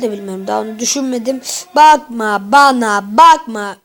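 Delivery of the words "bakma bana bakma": slow, loud drawn-out "don't look at me"; mock warning. Gamer warning the game creature off him, slow mock-stern. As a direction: stretch it out loud like warning off a monster.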